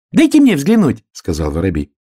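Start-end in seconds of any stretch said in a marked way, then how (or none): 0.1-1.0 high, loud little bird voice; excited high-pitched sparrow; eager sparrow. A narrator's reading. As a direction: pipe up loudly as an eager sparrow. Sparrow's acted voice.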